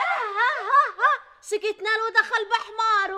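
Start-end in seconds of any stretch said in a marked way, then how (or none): 0.0-1.1 high, loud sarcastic ha-ha; mock laugh. Mother-in-law mocking Rania's slip.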